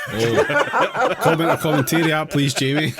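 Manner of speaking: loud, high, quick plea through laughter; laughing outburst. Real, not acted. Host laughing at the fan link, loud high cackling request. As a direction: crack up while calling out the request.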